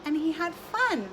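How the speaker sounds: ordinary talk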